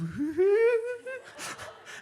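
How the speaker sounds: loud, high whooping chuckle; a gleeful cackle from the back. Retold or imitated.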